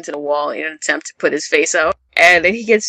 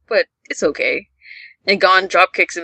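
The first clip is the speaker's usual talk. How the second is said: ordinary delivery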